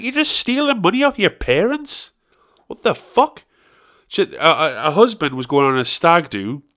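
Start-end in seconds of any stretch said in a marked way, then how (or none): 0.0-3.4 high, incredulous outburst; shocked disbelief. Retold or imitated. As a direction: squeal it in disbelief. His own shocked reaction.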